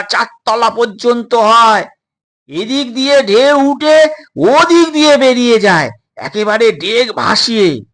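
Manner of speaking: high, loud hearty sailor voice; boisterous sailor. Acted. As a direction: boast about the giant waves. Sailor explains waves.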